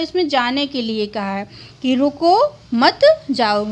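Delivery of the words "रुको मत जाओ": रुको held apart from मत जाओ by a comma pause; pause after रुको. Shown as a demonstration. Teacher explaining that going is forbidden, pause after रुको. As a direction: break after रुको, then give मत जाओ as its own command. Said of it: "रुको यहाँ लेकिन मत जाओ".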